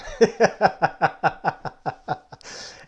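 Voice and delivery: loud, high laughter. Real, not acted. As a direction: laugh hard. Laughing at bathroom joke.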